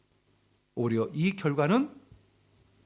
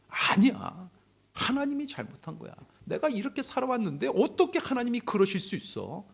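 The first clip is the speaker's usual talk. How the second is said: high-pitched protest as angry Job; mildly acted anger; 8 semitones higher than usual. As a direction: imitate indignant protest without raising the volume. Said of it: "욥은 또 그 엘리후에게 막 화를 냅니다". (높은 음으로 살짝 화내며 항변하는 흉내)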